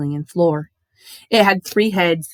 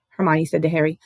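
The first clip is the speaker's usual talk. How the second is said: usual speaking voice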